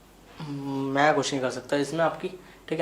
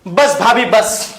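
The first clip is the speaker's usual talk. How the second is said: high, loud cut-off; loud, pleading stop; 4 semitones higher, 8 dB louder, much slower than usual. Acted. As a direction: cry out to make her stop. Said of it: "आपको कितनी बार बोला थोड़ा". (ज़ोर से टोकते हुए)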